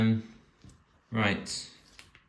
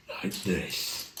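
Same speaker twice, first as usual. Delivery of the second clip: hushed, eerie ghost-train voice; a hint of a spooky storyteller; 4 dB quieter than usual. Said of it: "he sort of spooky voice".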